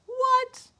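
high loud amazed what; be astonished at the boots; pig gasps in surprise. Acted. The pig amazed at the fancy boots, high and loud.

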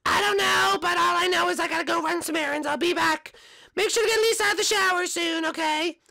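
high cartoon mom voice; sing-song motherly cartoon voice; mother greeting her son. Plays Marge the mom.

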